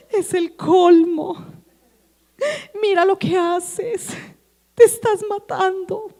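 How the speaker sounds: mock crying. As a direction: fake tears to make him feel guilty. (regaño lloroso y exagerado)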